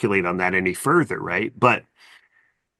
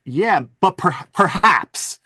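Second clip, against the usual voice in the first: higher-pitched, hedging doubt; gently acted skeptic's hesitation; mildly skeptical; 8 semitones higher, slower than usual. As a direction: concede it, then lift a doubtful caveat. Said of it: "I'm even a little bit of a skeptic".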